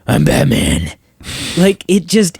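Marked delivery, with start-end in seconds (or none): 0.1-0.9 very low, overdone Batman voice; mocking the overdone Batman rasp; exaggerated Batman growl. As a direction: push the Batman growl way too far. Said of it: "It doesn't have to be".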